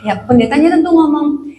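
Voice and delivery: usual speaking voice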